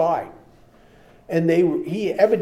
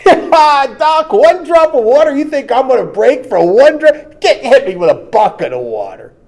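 loud, scornful tough-guy taunt, much louder; cocky macho spy; 11 semitones higher, 13 dB louder than usual. Retold or imitated. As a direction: laugh off the threat like a hardened spy. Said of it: "spy mocks the doctor".

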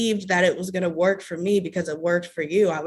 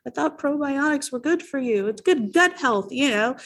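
naive excuse; 7 semitones higher than usual. Retold or imitated. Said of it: "I was like".

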